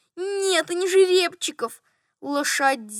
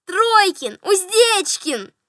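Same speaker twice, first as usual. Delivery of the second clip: put-on higher, louder child's call; children calling out; 5 semitones higher, 5 dB louder, much slower than usual. A narrator's reading. Children shouting guesses from the nursery, calling out. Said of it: "кричали из детской".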